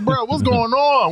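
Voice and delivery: mock bafflement. Retelling the reaction to his fangirling, mock bafflement. Retold ribbing of fangirling friend.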